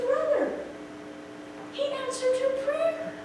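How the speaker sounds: high, emotional reassurance to a brother; gently insist, voice held high; high-pitched re-enacted comfort; earnest reassurance. Re-enacts reassuring her sobbing brother.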